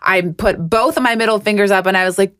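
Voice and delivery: normal talking voice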